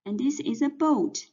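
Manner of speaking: normal talking voice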